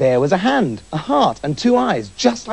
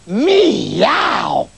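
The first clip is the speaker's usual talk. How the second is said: loud, high yowling meow; a doctor with cat's eyes yowling loudly at a mouse; 6 semitones higher, 3 dB louder than usual. Acted. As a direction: yowl like a hungry cat. Cat-eyed doctor yowls.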